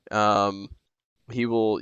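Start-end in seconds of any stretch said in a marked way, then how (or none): none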